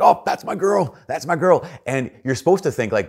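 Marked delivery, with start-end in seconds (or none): 0.0-1.7 high, loud, emotional cheer; choked-up proud dad. Retold or imitated. Sideline dad cheering his daughter's goal, choked-up and high. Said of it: "he's all choked up".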